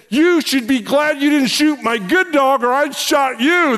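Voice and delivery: high-pitched, emphatic mock threat; retold angry threat. Pastor threatening the friend who shot his dog, acted anger.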